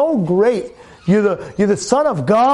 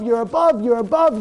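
usual speaking voice

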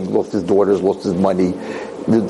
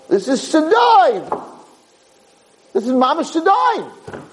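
outraged exclamation; slower than usual. Acted.

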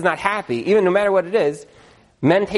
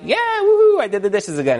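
hammed-up proud husband whooping; husband proudly cheering over the washed dishes; mock cheer; 7 semitones higher than usual.

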